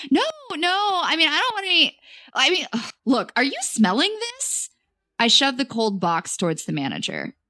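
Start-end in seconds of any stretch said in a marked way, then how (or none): 0.1-4.7 high, stumbling, flustered denial; flustered protest. A narrator's reading. Customer protests to manager about the smell, flustered. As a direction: jump up in pitch and trip over the words.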